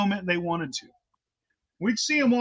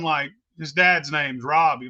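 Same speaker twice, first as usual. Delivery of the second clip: normal talking voice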